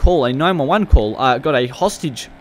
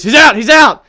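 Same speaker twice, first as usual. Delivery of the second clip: excited callout; 10 semitones higher, 11 dB louder than usual. Pretend. Suspect pulled from car.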